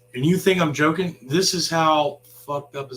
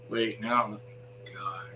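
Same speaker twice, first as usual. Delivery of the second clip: ordinary talk, nothing marked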